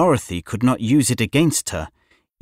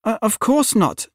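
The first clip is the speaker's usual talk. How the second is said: frightened; 10 semitones higher than usual. A narrator's reading. Frightened Dorothy answers witch.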